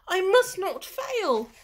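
a hint of the tiny snail, determined; lightly voiced snail vowing not to fail. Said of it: "said the tiny snail".

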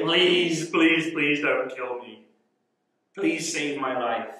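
slow, drawn-out plea; begging. Acted. Haman grabbing the queen's knees for his life, begging. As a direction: stretch out each plea for mercy. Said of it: "Begging her to save his life".

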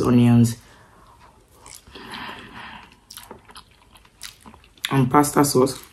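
speaking between bites; chewing while talking. Real, not acted. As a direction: mouth partly full of food. Seen 1: Lips pursed and chewing between lines while holding forkful of noodles.

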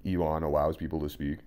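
normal talking voice

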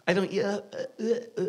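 ordinary talk, nothing marked

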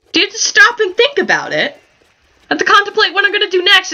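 a hint of a dithering game character; mock Sim voice. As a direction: play the Sim's dopey excuse. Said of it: "voices the Sim's excuse".

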